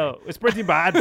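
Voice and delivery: put-on Scottish accent. Reading card text to co-host in mock Scottish. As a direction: keep the playful fake Scottish going. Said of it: "not your accent".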